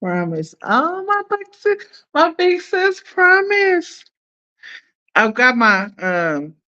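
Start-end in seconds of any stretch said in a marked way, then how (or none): 0.6-4.0 high-pitched, delighted gush; thrilled, way up high; excited gushing. Real, not acted. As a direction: gush over your big sister in a much higher voice. Gushing at her sister's message.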